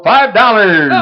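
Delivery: loud, high opening call; opening bid call. Real, not acted. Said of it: "please bid often and bid high".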